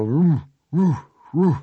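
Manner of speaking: high, loud mock dog bark. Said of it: "starting to go".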